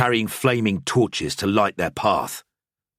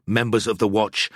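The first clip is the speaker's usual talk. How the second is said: ordinary delivery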